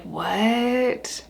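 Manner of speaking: quiet, mildly stunned retold "what"; dumbfounded. Finding the family just sitting there, quietly dumbfounded. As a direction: softly act out baffled disbelief.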